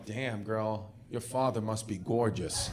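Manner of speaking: hushed, smooth pickup line; low pickup line. Pretend. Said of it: "you look at her and you go".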